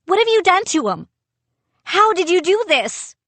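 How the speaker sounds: high, fast, desperate cry; crying out in panic at the captain; frightened cry. A narrator's reading. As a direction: plead in alarm. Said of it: "I cried".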